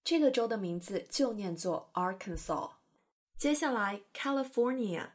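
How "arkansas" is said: "Arkansas" as AR-kan-saw, final S dropped; silent final S. Shown as a demonstration. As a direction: end "Arkansas" on an open "saw" without the S. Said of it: "但这个S不发音". ("Arkansas" 末尾的S不发音，读作 AR-kan-saw)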